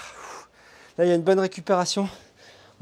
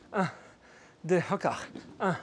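usual speaking voice

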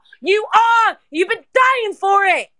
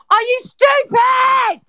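slow, high-pitched outraged shout; incredulous furious yell; angry shouting; 6 semitones higher, slower than usual. Real, not acted. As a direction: yell it as a real, drawn-out challenge. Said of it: "please stop shouting now".

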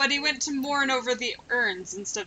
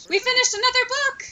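much higher, louder happy cheer; excited; 11 semitones higher, 3 dB louder than usual. Real, not acted. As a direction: cheer it like a small victory. Celebrating finished book in game.

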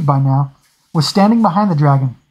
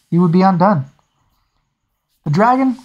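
plain reading voice

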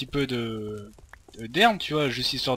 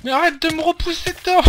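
high, loud exasperated yell; annoyed shout; 5 dB louder than usual. Real, not acted. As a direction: yell at him to stop pushing. Partner pushes him into lava.

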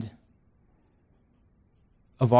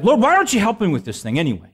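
mock complaint; 7 semitones higher, 4 dB louder, faster than usual. Mock complaining prayer to God.

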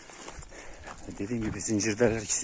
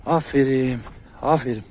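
loud, drawn-out praise to a dog; called-out praise; 3 semitones higher, 8 dB louder, slower than usual. Real, not acted. Trainer praises his dogs from a distance, called out loudly. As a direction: call out praise, raised well above talking level.

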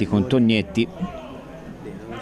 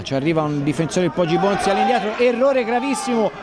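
high shouted play-by-play; shouting commentary; 11 semitones higher than usual. Acted. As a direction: yell the calls over the crowd. Goal-mouth scramble shout.

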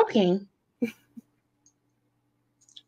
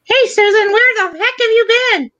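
mock scolding; 5 semitones higher, 6 dB louder than usual. Mock scolding a late friend.

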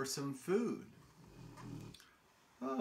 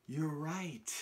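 ordinary delivery

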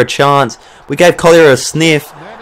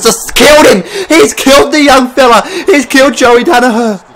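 excited shout; 8 semitones higher than usual. Real, not acted. Big tackle in match.

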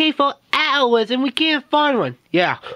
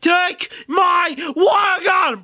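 plain reading voice

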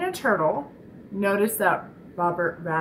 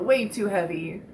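ordinary talk, nothing marked

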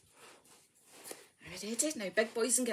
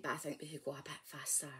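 fast conspiratorial whisper; 8 semitones lower, 11 dB quieter, faster than usual. Acted. Leader whispering to the group, quick whisper. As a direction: whisper a secret plan.

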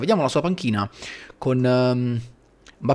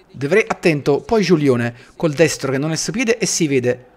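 usual speaking voice

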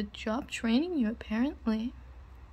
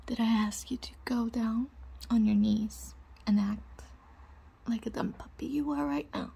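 whispering. Acted.

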